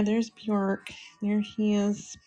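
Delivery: ordinary talk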